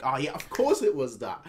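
ordinary talk, nothing marked

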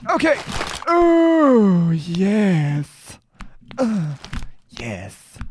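sudden squeaky high "Oh, yes"; mock-delighted squeal; silly high voice. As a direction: pop way up into a goofy falsetto. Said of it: "Why am I talking like that?".

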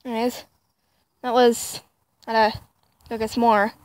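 ordinary talk

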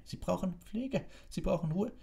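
very high-pitched, insistent matron; spluttering protest. A narrator's reading. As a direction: protest for your patients' sake. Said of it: "prustete Madame Pomfrey". (hoch und empört protestierend)

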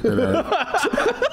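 mock slow request. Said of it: "I would have said".

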